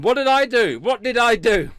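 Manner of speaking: high, loud protesting questions; mock indignation. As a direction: protest your innocence with hammed-up outrage.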